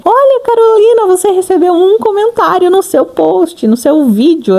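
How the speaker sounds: mock app voice calling her by name; YouTube notification voice. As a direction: play the YouTube message like a cheerful alert. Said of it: "ele até me manda uma mensagem".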